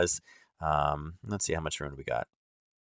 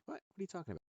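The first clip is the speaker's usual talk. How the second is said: talking to the bird; 11 semitones higher, 14 dB quieter, faster than usual. Real, not acted.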